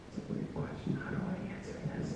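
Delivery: breathy whispered inner thought, half laughing; whispered aside. Acted. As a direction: whisper it to yourself, puzzled.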